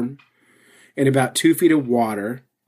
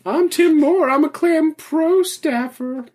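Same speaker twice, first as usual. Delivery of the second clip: high-pitched, drawn-out mocking voice; mock a show-off friend in a silly high voice; slow, squeaky-high braggart; Tim Moore impression; 14 semitones higher, slower than usual. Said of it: "That's an actual impression".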